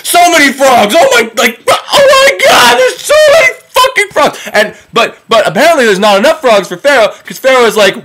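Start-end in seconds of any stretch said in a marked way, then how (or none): 0.1-4.5 mock horror. Storyteller overwhelmed by plague of frogs, mock horror. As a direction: cry out in exaggerated panic at the swarm.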